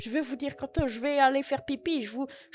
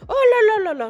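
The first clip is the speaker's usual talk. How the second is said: surprised oh là là; 8 semitones higher, 11 dB louder than usual. Real, not acted. Surprised at supermarket promotions.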